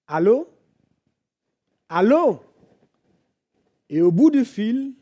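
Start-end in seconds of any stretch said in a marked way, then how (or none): 0.1-2.4 loud, insistent phone hello; retold cry. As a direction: call louder down a silent line. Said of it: "il crie". (allô crié au téléphone)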